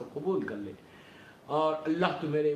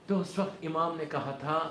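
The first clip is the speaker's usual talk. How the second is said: ordinary talk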